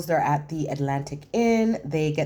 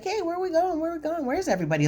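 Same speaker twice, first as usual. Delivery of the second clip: high, puzzled Alicia; 13 semitones higher, much faster than usual. Retold or imitated. Alicia on the empty beach, asking high and puzzled. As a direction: voice jumps way up, puzzled. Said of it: "she's like".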